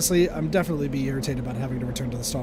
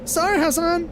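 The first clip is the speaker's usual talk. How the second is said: shouted apology; 13 semitones higher, 5 dB louder than usual. Acted.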